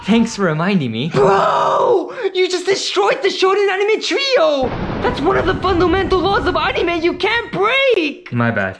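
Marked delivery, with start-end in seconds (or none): 1.1-8.3 high, worked-up ranting; exasperated rant. Acted. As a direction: rant like an outraged fan. Ranting about anime rules.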